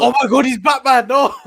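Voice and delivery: high, hammed-up horrified gasp of words; mock shock. Co-host playing a shocked bystander learning Batman's identity, hammed-up mock shock. Mocking onlookers' shock at the secret.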